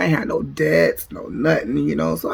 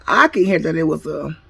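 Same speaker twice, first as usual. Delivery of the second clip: ordinary delivery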